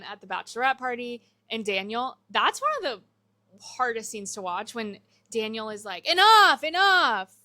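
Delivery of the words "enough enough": high, loud exasperated outburst; mock shout. Fiancé snapping at his bride, loud mock shout. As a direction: yell it like the fed-up fiancé. Fletcher angrily stops bride.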